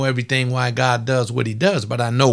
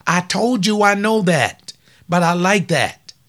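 ordinary talk, nothing marked

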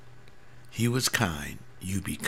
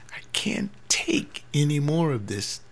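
whispered despair; 5 semitones higher than usual. Real, not acted. Pastor despairing about the church.

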